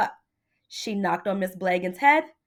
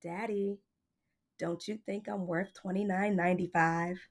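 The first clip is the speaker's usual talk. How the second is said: much softer, hushed question; quietly; 2 semitones lower, 7 dB quieter than usual. A narrator's reading. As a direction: ask it gently and softly. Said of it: "Miss Blagan quietly said".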